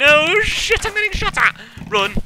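high, loud panicked shriek; shrieking while being shot at; panicked yelp. Real, not acted. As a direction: yelp in real alarm. Fleeing mobs in panic.

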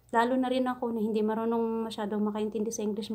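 normal talking voice

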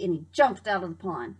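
ordinary delivery

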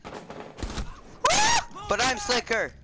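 high, loud, drawn-out gloat; stretched-out victorious yell; gloating boast. Real, not acted. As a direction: crow it slowly in triumph. Gloats after outplaying an opponent.

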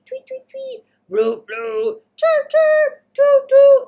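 chirpy birds and hooting boats, played for kids; bird and boat noises. Reader voicing the noisy harbour for children, playful chirps. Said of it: "it's still noisy".

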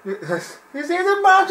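high, slow, slurred drunk imitation; high, slow mock drunk; drunk-sounding contender. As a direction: mimic a tipsy man asking. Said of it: "It sounded like he was drunk".

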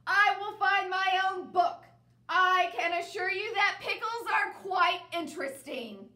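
loud, high, indignant; loud huffy declaration. Acted. Bear stubbornly insists on pickles, still shouting. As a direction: keep shouting, offended.

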